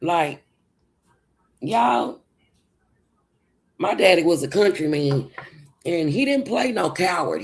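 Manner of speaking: ordinary talk, nothing marked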